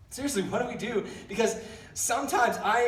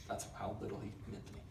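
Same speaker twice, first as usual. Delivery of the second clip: whispered aside; 12 semitones lower, 17 dB quieter, faster than usual. Real, not acted. Throwaway aside about ex.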